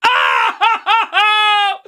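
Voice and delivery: loud, high cry in broken bursts; stuttering scream. Real, not acted. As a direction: wail out in repeated high bursts. Screams at the failed catch.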